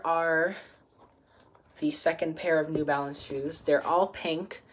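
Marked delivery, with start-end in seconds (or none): none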